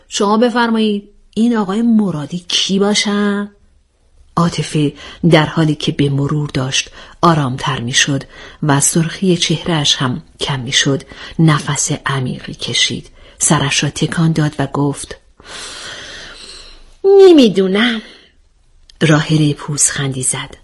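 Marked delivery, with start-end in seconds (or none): 0.1-3.5 orderly, mock-formal polite question; composed questioning. A narrator's reading. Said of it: "آرامشتون رو حفظ کنید". 17.0-18.0 high-starting, louder denial falling away. A narrator's reading. Character denies knowing when questioned.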